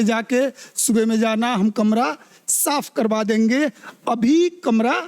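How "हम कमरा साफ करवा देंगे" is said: ordinary talk, nothing marked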